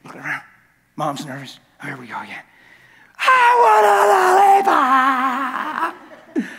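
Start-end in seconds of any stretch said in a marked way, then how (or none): 3.2-6.5 high, slow, wailing cry-shout; child tantrum. Retold or imitated. Kid throwing a tantrum at mom, crying and yelling. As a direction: bawl it like a toddler on the floor.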